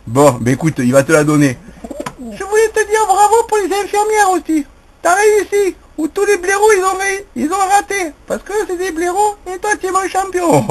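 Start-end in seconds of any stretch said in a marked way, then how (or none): 2.2-10.6 very high pretend voice for the pet; a very high squeaky pet voice asking Nicolas a favour; voicing Bernard. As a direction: speak for the bird in a very high put-on voice. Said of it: "dis quelque chose à Nicolas".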